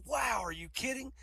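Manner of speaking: high-pitched stunned "Wow"; amazed. Acted. Engineer stunned by the salary package, high amazed voice. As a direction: gasp it out, can't believe the offer.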